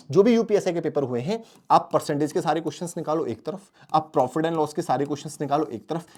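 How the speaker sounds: ordinary talk, nothing marked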